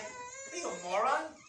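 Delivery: a hint of a contemptuous partner; mock aggressive insult. Partner insults spouse over the cutlery, mildly contemptuous. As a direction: sneer it out, lightly. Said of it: "outwardly aggressive".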